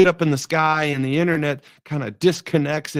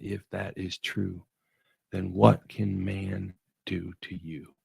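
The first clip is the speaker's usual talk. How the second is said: low solemn scripture; 7 semitones lower, 8 dB quieter than usual. Acted. Preacher reciting Hebrews to listeners, low hushed.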